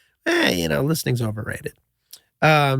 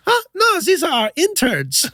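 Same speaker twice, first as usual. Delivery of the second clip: mock Swiss host; 9 semitones higher than usual. Swiss guy answers casually.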